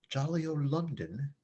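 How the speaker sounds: playful put-on British accent; jokey British lilt; mock British accent. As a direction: say it like a hammed-up Londoner. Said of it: "I'm not doing a German accent".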